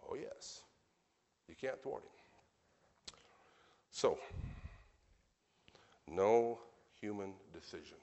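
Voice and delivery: normal talking voice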